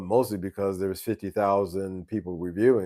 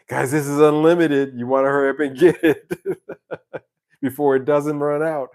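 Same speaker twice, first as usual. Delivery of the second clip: loud, higher mock sales pitch breaking into laughter; laughing mock pitch; 5 semitones higher, 6 dB louder than usual. Guitar seller urging buyers to hurry, laughing mock pitch. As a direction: hype it loudly, cracking up at the joke.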